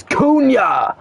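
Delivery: sudden high shout of the name, falling away; excited call. Real, not acted. Commentator calling a shot on goal, excited high shout. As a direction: leap up and yell the name as he shoots.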